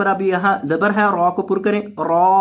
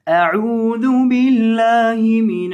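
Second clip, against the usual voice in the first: slow melodic tilawat chant; Quranic recitation; 3 semitones higher, much slower than usual. Acted. Teacher opening the lesson with recitation, chanted. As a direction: recite with tajweed, drawn out.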